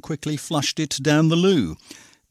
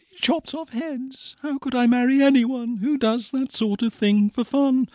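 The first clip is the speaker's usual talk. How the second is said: high-pitched dismayed musing; high voice of an appalled heroine; Cindy thinking; 10 semitones higher than usual. A narrator's reading. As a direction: think aloud in a high, troubled girlish voice. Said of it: "she thought".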